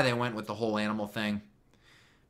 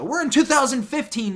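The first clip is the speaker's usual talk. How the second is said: indignant outburst; 12 semitones higher, 8 dB louder than usual. Real, not acted. Complaining about the show's date.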